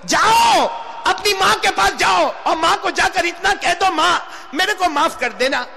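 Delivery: high, forceful preacher's plea; urgent exhortation. Acted. Preacher sending listeners to ask mother's forgiveness, urgent exhortation. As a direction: urge them at full pitch.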